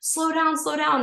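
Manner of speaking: high, urgent pleading. Retold or imitated. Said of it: "I just kept saying".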